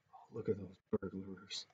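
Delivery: usual speaking voice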